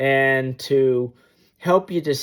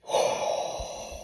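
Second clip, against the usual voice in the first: long, soft breathy sigh out; sigh of relief; 8 dB quieter than usual. Retold or imitated. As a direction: let all the tension go. Plane finally stops.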